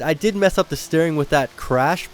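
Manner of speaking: ordinary talk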